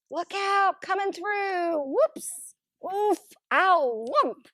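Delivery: high, loud yelps while bumping about; clumsy cries while fumbling through a dark room; stumbling in the dark. Acted. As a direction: yelp as you crash into things.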